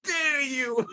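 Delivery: high, slow mock dare; raging gamer. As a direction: taunt him, pitch way up. Mock challenge over playmat.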